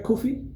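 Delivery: "Kofi?" asked softly with a high rise; unsure name check. Shown as a demonstration. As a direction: test "Kofi" as a hesitant question, pitch up. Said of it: "I'm saying it right".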